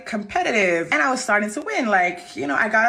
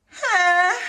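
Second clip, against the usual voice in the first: high, louder stunned "huh"; stunned disbelief; 11 semitones higher, 4 dB louder than usual. Real, not acted. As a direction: react as if you can't believe it. Shocked by hammer threat.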